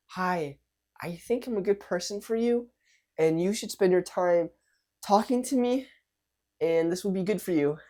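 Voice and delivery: high-pitched, hesitant self-pitch in short pieces; unsure, timid vibe. Shown as a demonstration. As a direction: plead timidly, high, pausing between phrases. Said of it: "Two different vibes are coming out".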